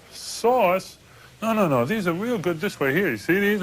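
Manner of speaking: high, amused disbelief; incredulous. Retold or imitated. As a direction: scoff at the idea of sauce. Reacts to being asked about sauce.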